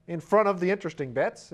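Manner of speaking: normal talking voice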